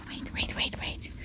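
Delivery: whispered wait to the dog; whispering. Real, not acted. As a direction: hush it out to the dog.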